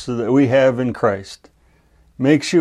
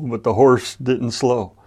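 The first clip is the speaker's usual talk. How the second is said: ordinary delivery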